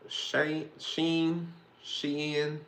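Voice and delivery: sounding out a strange name; guessing pronunciations. Shown as a demonstration. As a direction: fumble through guesses at Sheehan. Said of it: "however you pronounce it".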